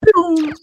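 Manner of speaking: very high, loud zap; one sharp cartoon blaster shot; laser pew. Host firing a single laser sound. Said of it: "pew pews".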